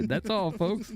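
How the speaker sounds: high, rapid babbling stutter into a catchphrase; mock stuttering cartoon voice; cartoon stutter sign-off. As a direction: stammer wildly like a cartoon character, then sign off. Stumbled word turned into cartoon stutter.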